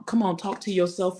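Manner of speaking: ordinary talk, nothing marked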